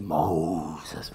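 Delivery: low, drawn-out call from God; deep, slow divine voice. Acted. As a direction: call the name slowly as God. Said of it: "It's my best God voice".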